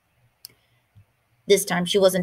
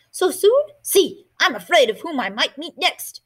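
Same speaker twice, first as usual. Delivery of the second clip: put-on much higher, louder, old woman's surprise; surprised grandmother; 8 semitones higher, 3 dB louder than usual. Grandmother surprised Martina is going inside. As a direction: lift it up like a startled abuela.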